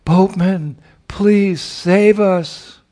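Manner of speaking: high, loud, slow desperate plea; calling out. A narrator's reading. Radha calls to the boatman in a desperate loud plea. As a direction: stretch out the cry for help. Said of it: "she called out to the boatman".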